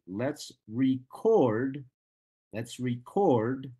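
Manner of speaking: "record" slowly as a verb, re-CORD; stress on second syllable. Shown as a demonstration. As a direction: stretch and punch CORD in "record". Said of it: "But as a verb".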